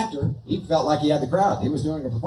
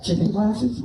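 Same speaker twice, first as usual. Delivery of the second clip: high squeaky dummy voice; ventriloquist dummy voice; 4 semitones higher, slower than usual. Acted. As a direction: pitch it up like a puppet.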